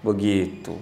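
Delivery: ordinary delivery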